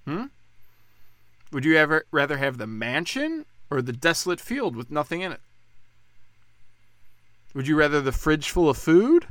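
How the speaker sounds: usual speaking voice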